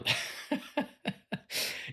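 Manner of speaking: sudden high burst of giggles; laughing. Real, not acted. Vlogger cracks up mid-sentence, high giggling. Breaks off laughing at a stray thought.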